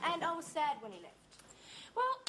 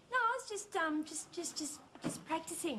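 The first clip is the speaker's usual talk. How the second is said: flustered stammer. Acted. Caught talking alone, fumbles an excuse.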